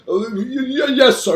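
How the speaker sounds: high, choked-up stuttering assent; gulping stammer. A narrator's reading. Father finally answering the caller, flustered. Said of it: "gulping like he has a lobster stuck".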